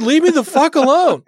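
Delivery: loud high snap at the intruder; angry shout. Retold or imitated. Imagined outburst at coworker.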